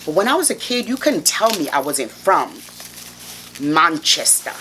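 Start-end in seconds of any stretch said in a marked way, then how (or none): 3.6-4.5 put-on British Manchester, ending "-stah"; British accent. Shown as a demonstration. Showing off her childhood claim to Manchester, put-on British accent. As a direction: say "Manchester" as a would-be Brit. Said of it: "self-conscious about my British accent".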